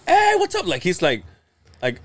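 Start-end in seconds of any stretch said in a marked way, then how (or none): 0.1-0.7 high-pitched, chummy hello; mock friendly greeting. Son showing how dad never greets him on calls, mock eager. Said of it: "but it's not like".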